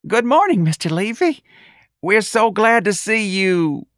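high, loud nervous squeak; squeaky. A narrator's reading. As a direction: squeak it out, terrified and fawning. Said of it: "he squeaked".

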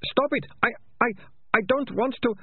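high, desperate plea; tearful stutter. A narrator's reading. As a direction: plead and stammer, pitched right up. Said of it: "Tears ran down his face".

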